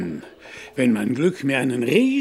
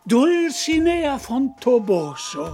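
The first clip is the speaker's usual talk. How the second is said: slow, high, ringing proclamation of the name; grandly announced; 9 semitones higher, 4 dB louder, slower than usual. Acted. Knight proudly names his imagined lady, high and slow. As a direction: savour the name like a herald.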